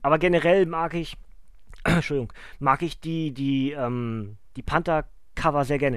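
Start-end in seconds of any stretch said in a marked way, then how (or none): 1.2-1.8 throat clearing after swallowing wrong; choking cough. Real, not acted. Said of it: "Ich hab mich grad verschluckt".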